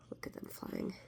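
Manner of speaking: soft unvoiced whisper. Real, not acted. Parent pointing out the picture to a child, whispering. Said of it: "whispered aside to child".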